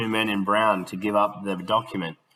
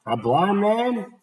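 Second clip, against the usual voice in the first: higher, slow gasped disbelief; 11 semitones higher, 3 dB louder, slower than usual. Acted. Smith gasping in shock at the blind man, higher and slow. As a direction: gasp it out in shock. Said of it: "gasped Smith".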